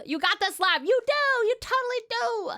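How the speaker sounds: very high cheerleading squeal; excited encouragement. Real, not acted. Cheering a viewer on.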